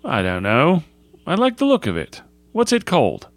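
high-pitched schoolboy, eager to know; curious schoolboy. Acted. Stanley's voice in dialogue.

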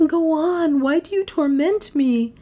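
high, anguished pleading; agonised woman's voice, pitched high; tormented heroine. A narrator's reading. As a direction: urge him on in distress. Tormented heroine urges him on.

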